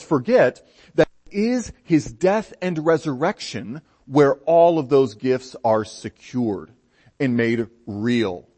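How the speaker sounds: normal talking voice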